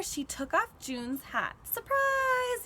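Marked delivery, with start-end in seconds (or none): 1.6-2.6 high, excited group yell; spring the surprise on her; party surprise shout. A narrator's reading. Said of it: "yells the Riveteers".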